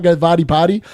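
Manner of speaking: normal talking voice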